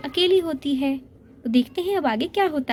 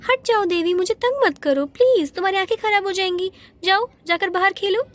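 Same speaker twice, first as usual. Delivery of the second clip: annoyed shooing; 7 semitones higher than usual. Acted. Rosie shooing away children.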